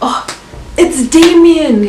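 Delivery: acted gasp, then a loud, high, drawn-out cry; gasping realisation. Storyteller acts out the painter recognising a face, gasping realisation. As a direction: draw the name out in dramatic astonishment.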